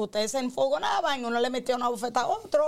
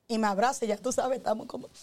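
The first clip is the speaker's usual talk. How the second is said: ordinary talk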